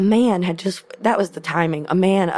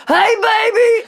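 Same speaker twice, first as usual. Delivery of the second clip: much higher, louder, laughing call of a cheerful dad; dad's greeting; 6 dB louder, slower than usual. Retold or imitated. Dad calls out to his daughter across the lot, high and laughing. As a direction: sing it out high like a proud dad spotting his girl. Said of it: "my dad goes".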